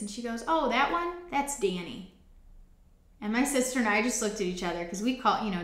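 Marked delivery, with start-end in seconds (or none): 0.5-2.1 high-pitched imitation of a casual reply; offhand recognition. Animal owner asked the donkey's name, offhand. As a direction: lift it high, as if only now noticing.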